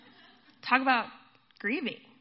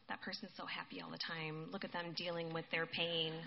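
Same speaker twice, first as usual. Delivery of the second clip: low, quiet resentful muttering; bitter grumbling; 5 semitones lower, 10 dB quieter than usual. Acted. Bitter griever envying someone happy, resentful grumbling. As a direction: mutter it sourly, low and soft. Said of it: "like a bitter person".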